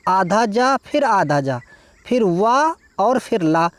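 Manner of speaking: slow, spelling out each letter of Ujjwal. Shown as a demonstration. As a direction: dictate letter by letter, slowly. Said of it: "देखिए कैसे लिखेंगे".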